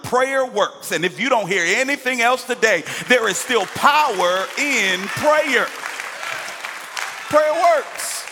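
high, loud building preacher's cry; preaching climax. Acted.

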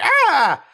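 high, loud "Ah!"; imitated yelp. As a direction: cry out as if caught by the neck. Imitates a character's cry.

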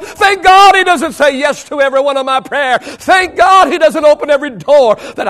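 high, loud preacher's cry; full-force preaching. Real, not acted. Preacher thanking God for unanswered prayers, full-force preaching. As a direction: thunder it out to the congregation.

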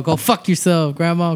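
normal talking voice

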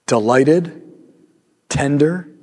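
a pastor gently guiding the congregation to picture Jesus; guided meditation. Acted. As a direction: lead them into calm, unhurried.

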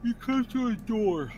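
words stretched by a yawn. Real, not acted. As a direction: say it mid-yawn. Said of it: "Sorry, I yawned".

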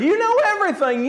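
frustrated; acted exasperation, very high-pitched plea; Peter. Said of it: "he was frustrated".

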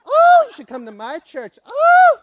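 squealing high and loud, a hammed-up fan; over-the-top delighted churchgoer; mock gushing. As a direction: gush about the church in a shrill squeak.

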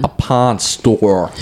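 A pawn store drawn out slowly, each word separate; slow careful pawn store. Shown as a demonstration. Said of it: "make it not sound crazy".

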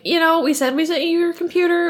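a hint of an excuse-making company rep; lightly voiced company rep. Computer company excusing itself to a customer, lightly mocked. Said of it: "the computer company was like".

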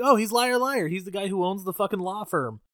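light aha moment of remembering; recognition. Retold or imitated. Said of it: "I'm like".

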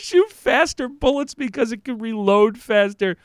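mocking disbelief. Mocking senator's quote in disbelief.

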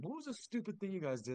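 high-pitched put-on kid voice; mocking needling. Hardcore Blue Coats kids teasing about past lines, mock needling.

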